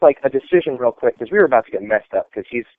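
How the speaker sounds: normal talking voice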